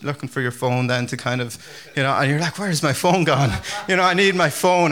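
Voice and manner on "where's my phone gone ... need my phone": raised, louder comic searching; mock flustered. Caller hunting for the phone already in use, mock flustered. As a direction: call out as if hunting for something lost.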